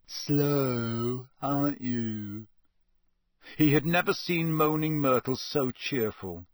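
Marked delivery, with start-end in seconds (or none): 0.0-2.5 put-on drawn-out, taunting ghost girl. Ghost teases puzzled Harry, drawn-out taunt. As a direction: stretch each word, needling him.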